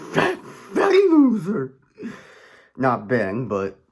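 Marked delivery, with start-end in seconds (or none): 0.1-1.7 high, loud, slow jeer; hammed-up bully's squeal; mocking taunt. Mock taunt at the boy.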